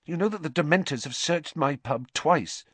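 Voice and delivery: high-pitched, edgy complaint; slight edge. A narrator's reading. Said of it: "a slight edge to her voice".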